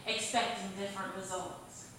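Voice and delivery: punchy, rhythmic stage recitation, line openings hit hard; spoken-word poem. Acted. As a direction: perform the verse for an open-mic crowd. Recites her insanity poem.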